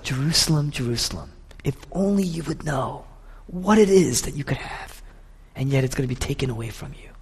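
hushed lament, falling away softly; acted grief, pleading gently; Jesus weeping. Jesus crying out over the doomed city, weeping softly. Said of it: "He's weeping".

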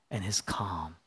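whisper. Acted. Preacher whispering of God's calm to the listeners. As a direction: breathe it out gently.

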